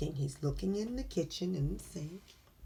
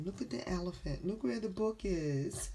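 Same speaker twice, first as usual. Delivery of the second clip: usual speaking voice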